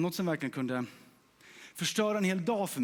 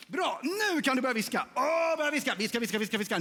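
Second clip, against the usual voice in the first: eager urging; 8 semitones higher than usual. Real, not acted. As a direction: fire up the room. Urging the audience to start.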